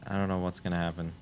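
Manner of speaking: ordinary delivery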